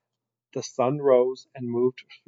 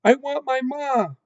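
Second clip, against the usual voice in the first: high, loud wail of longing; crying out; 12 semitones higher, 6 dB louder than usual. A narrator's reading. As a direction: cry out high for a lost mother. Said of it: "cried Mig into the silent".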